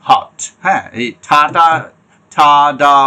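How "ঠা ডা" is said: ঠা and ডা said louder with a strong burst of air; teacher shows aspirated stops ঠা, ডা; plosive demo. Shown as a demonstration. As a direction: pop the plosive in ঠা, ডা. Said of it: "একটু differently pronounce হয়".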